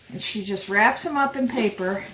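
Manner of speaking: ordinary talk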